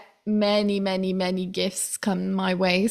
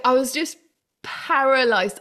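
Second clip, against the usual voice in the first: usual speaking voice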